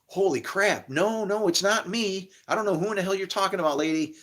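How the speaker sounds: high, fast startled protest; panicked denial. Retold or imitated. Startled man denying to an accusing lady. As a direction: back off in alarm, talking fast. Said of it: "And I'm like".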